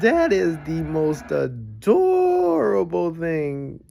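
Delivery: slow, drawn-out adoring gush. Real, not acted. Melting at puppy cheerleaders, slow and adoring. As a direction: stretch "adorable" out like cooing at puppies.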